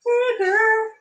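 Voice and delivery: very high sing-song call; sing-song pet call. Real, not acted. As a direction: coax the cat sweetly. Calling the cat sing-song.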